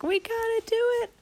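put-on high-pitched child squeal; eager kids. Kids urging parents to take panda, eager. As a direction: plead excitedly in a tiny high voice.

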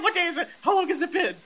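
mock panicked high moan; crying and moaning. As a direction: whine it like a terrified man waking from a spell. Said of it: "sounded like Paul Bearer with all the crying and moaning".

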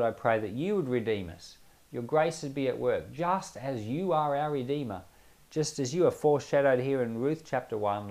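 ordinary talk

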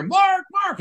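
high-pitched imitation of someone calling a name. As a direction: mimic an urgent call across the room. Retold urgent calling across room.

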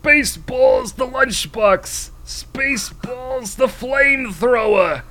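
high, loud, stretched-out pitchman cry; merchandise huckster. Acted. Merchandiser showing off Spaceballs products, high loud salesman's pitch. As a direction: hawk each product loudly, way up high.

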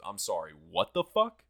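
imitating an incredulous mother, loud; shocked mom. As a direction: demand an explanation like a stunned mom. Mom's shocked reaction, retold.

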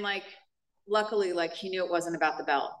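normal talking voice

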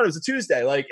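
ordinary talk, nothing marked

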